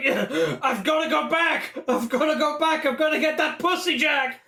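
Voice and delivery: high-pitched mock frantic begging; hammed-up desperate pleading. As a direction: plead frantically, speeding up toward the end. Acts out Daniel's desperate plea.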